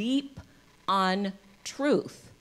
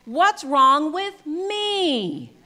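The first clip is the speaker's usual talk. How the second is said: very slow, higher and louder, pleading; child's anguished cry; 8 semitones higher, 11 dB louder, much slower than usual. Pretend. A child to its parent, slow anguished cry. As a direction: cry it out as a hurt little child. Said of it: "The child says".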